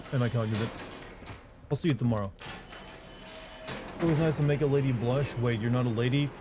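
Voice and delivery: usual speaking voice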